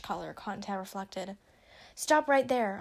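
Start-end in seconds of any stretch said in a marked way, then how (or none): 1.9-2.8 loud, higher-pitched command; raised voice, narrated yell; sudden yell. A narrator's reading. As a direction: call out sharply to halt strangers. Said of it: "a loud voice suddenly yelled".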